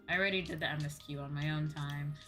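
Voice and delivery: ordinary talk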